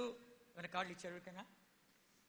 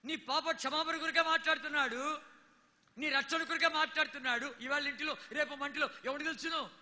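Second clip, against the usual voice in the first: louder, ringing sermon cadence; preaching at full force; 5 semitones higher, 6 dB louder than usual. Acted. Preacher urging the congregation, full-force preaching. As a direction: preach it hard to the crowd.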